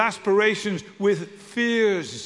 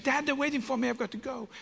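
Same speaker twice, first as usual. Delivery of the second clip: put-on higher, whiny teen plea trailing off; impatient teenage daughter; 6 semitones higher, 7 dB quieter than usual. Daughter pleading with dad because friends are waiting. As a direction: hurry him along like an impatient teen.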